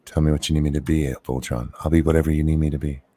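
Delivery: low, creepy come-on voice; creepy seductive voice. Pretend. Teasing Voltron with a creepy, low mock-seductive purr. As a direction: purr it at him like a bad seducer. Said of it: "That's a horrible sound".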